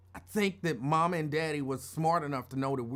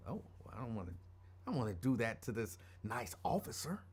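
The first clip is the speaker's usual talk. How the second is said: soft, low aside; hushed, deferential musing; mock meek reply; 6 semitones lower, 8 dB quieter than usual. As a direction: think it quietly to yourself, meekly. Daddy's warning, mock-meek reply about officer.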